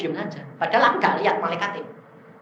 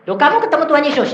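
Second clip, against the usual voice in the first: high, loud, rapid question; acted-out astonished quizzing; eager questioning; 11 semitones higher, 7 dB louder, faster than usual. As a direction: fire the question out fast and high. Replays quizzing the shaman.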